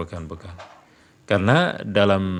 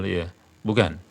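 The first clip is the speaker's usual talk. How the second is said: ordinary delivery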